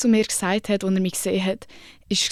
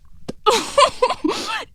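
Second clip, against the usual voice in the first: a high, breathless giggle bursting out. Real, not acted. Telling friends about the judge, a high disbelieving giggle. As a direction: laugh in disbelief, high and airy.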